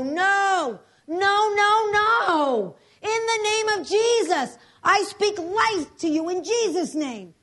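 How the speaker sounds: high, loud defiant shouts of no; shouting prayer. Retold or imitated. Pastor refuses the paramedics' verdict, loud defiant shout. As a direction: refuse death at full voice. Final desperate rebuke.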